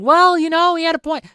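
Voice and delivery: high-pitched mock excuse-maker; imagined apologist. Voices an imagined defender's excuse.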